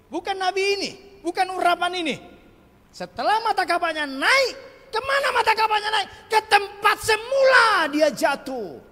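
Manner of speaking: loud, high preacher's cry; high shouted sermon climax; preaching at full force. Acted. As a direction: drive it home to the congregation.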